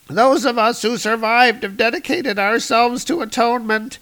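very high, fervent alien preacher; high alien zealot voice. Acted. Second alien, much higher voice.

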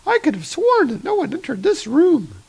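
put-on high, airy voice of a young nurse. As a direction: play the nurse up high and prim.

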